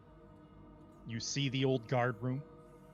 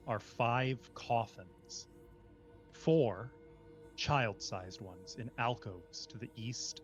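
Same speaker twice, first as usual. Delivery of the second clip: slow, measured dungeon description; scene setting. A narrator's reading. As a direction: paint the room for the players. Game master's scene setting.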